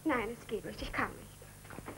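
soft, almost whispered no; hushed refusal. Acted. (leise, fast geflüsterte Ablehnung)

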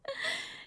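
a quick light laugh that jumps up high; amused chuckle. Real, not acted. Amused by the reply about the dried fish, a quick light chuckle. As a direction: let a short amused laugh slip out.

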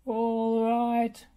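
a lightly voiced rumbling roller; rumbling Rolly. A narrator's reading. Rolly answers Bob with a light rumble. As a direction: roll the words out with a rumble. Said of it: "rumbled Rolly".

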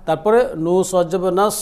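ordinary talk

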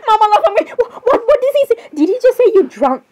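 high, incredulous outcry; shocked outburst. Acted. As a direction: react in loud, high-pitched disbelief. Shocked reaction to the clip.

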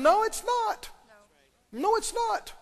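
high, insistent "No, it's not"; emphatic rebuttal. Acted. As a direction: shut the excuse down firmly. Preacher rebuts the excuse.

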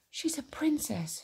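slow, high, hushed wistful musing; wistful near-whisper; musing to himself. A narrator's reading. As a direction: think aloud softly and slowly. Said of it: "he said to himself".

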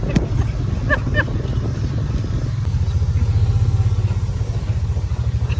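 long breathless high laughter; long loud laugh. Real, not acted. Laughing along on ride.

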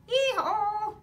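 loud high hee-haw bray; donkey bray. Acted. Reader braying as the donkey, loud and high.

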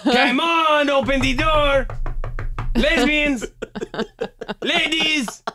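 high giggly call through door; laughing call. Acted. Calling through locked door, laughing.

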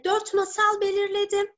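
ordinary talk, nothing marked

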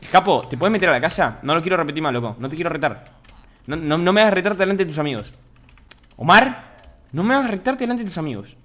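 mock parent scolding. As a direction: scold him like a kid in front of friends.

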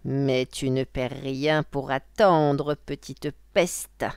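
slow, drawn-out witch threat; slow, emphatic witch voice; witch's menacing thought. Acted. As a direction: stretch out the threat as a scheming witch. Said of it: "pensa la sorcière".

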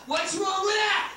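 high, drawn-out mocking whine. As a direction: whine the catchphrase up high and slow. Repeated mocking catchphrase.

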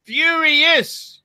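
high, emphatic single word; furious. Real, not acted. Host driving the point home, high and furious. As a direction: hammer the word with indignation. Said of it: "I am fucking furious".

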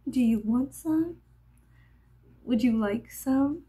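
lightly mocking imitation of an offer; a hint of mockery in the offer; making-fun-of-people voice. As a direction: mimic her with a slight lift. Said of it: "It's like my making fun of people voice".

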